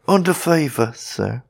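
timid, deferential fright; horror-struck. A narrator's reading. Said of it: "replied the horror-struck Saxton".

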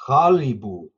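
teacher modelling Qalipu; careful Qalipu. Shown as a demonstration. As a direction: demonstrate Qalipu with the throaty Q up front. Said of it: "let me do this for a little quick lesson".

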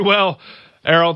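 normal talking voice

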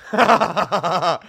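bursting out laughing, loud; a real laugh right after the punchline. Real, not acted. Comic laughing at the joke he just told, loudly.

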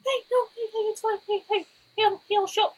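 very high, frantic soothing; hey-hey in falsetto panic; panicky Tyler. Acted.

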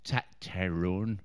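low, slow Northern Irish mimicry; Northern Irish accent. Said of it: "found them quite aggressive sounding".

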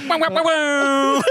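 mock wow. Mock reaction.

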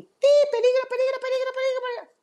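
very high, loud alarm squeal; reptile brain voice. Retold or imitated. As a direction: shriek the warning like a panicked lizard. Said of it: "ahí está el reptil". (voz muy aguda de reptil alarmado)

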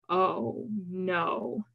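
hushed, shaken dismay; voice shaking. A narrator's reading. Keth learning who she is, voice shaking. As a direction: say it softly, with understated dread. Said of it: "he said, voice shaking".

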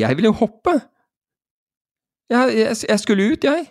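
very high-pitched mock protest; indignant protest. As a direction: insist like a wronged student. Student protests, retold.

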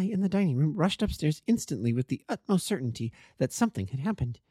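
woman character in earnest. Acted.

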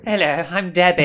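loud, hammed-up Queens accent; mock New York Queens greeting; Queens twang. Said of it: "a bit of a Queen's twang".

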